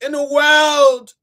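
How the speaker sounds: loud, high, slow shout. Shown as a demonstration. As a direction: belt out world to the crowd. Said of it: "Can I say the world, everybody?".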